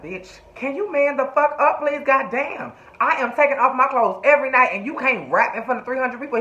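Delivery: high-pitched imitation of a fed-up telling-off; exasperated scolding. Mississippi scolds stage-frightened rapper, acted.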